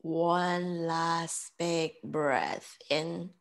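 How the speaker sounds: slow, measured meditation voice with long pauses; guided breathing. Real, not acted. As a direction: count them calmly through each breath, unhurried. Leads breath counting and meditation.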